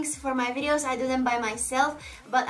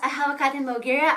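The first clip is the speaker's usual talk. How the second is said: ordinary talk, nothing marked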